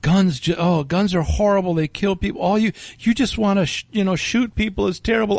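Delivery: high, fretful mocking lady voice; mock fussy lady. Storyteller mocking the anti-gun woman, high and fretful. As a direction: whine it out like a prissy diner lady.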